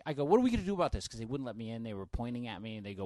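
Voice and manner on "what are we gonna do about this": loud, high, worked-up demand; agitated retelling. Retold or imitated. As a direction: act out frantic appeal to wife. Retells agitated call to his wife.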